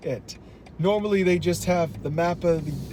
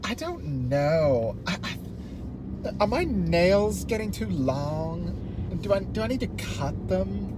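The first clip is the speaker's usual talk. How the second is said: put-on Valley Girl; 2 semitones higher than usual. Shown as a demonstration. Friend asked for more Valley Girl, Valley Girl. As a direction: stretch the vowels like a ditzy Valley Girl. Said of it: "Wait, do more Valley Girl".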